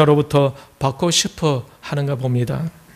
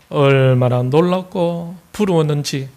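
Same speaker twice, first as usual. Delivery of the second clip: normal talking voice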